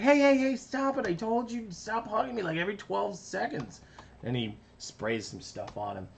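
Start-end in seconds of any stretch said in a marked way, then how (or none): 0.0-3.8 high irritated big brother cartoon voice; Boudreaux voice. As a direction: push him off, fed up. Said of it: "And he's like".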